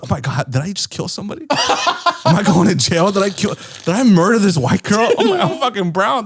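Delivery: high, half-laughing panic; panicked realization. Retold or imitated. Fearing jail over the missing girl, high comic panic.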